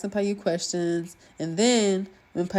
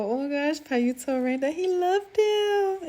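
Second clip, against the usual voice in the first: gushing; 9 semitones higher than usual. Real, not acted. Gushing over the confession.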